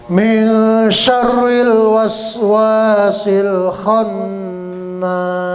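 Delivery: slow drawn-out melodic chant; Quran recitation. Shown as a demonstration. Teacher reciting the whole surah for students. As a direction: recite the surah slowly with tajwid.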